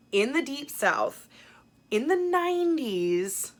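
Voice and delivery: slow, high, drawn-out storyteller voice; hamming up a spooky Southern setting; dramatic drawl. Pretend. Vlogger teasing the book's setting to viewers, high and drawn out.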